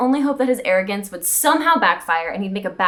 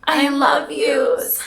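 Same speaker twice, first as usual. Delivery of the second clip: very slow and pained; slow, high mock-pained love; slow drawn-out I love yous; 3 semitones higher, much slower than usual. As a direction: stretch it out mock-tragic. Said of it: "exchanged pained".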